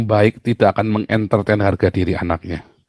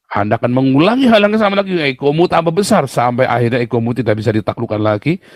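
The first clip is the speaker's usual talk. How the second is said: preaching; 4 semitones higher than usual. Acted. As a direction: drive the warning home to the congregation. Preaching at full force. (berkhotbah dengan lantang dan berapi-api)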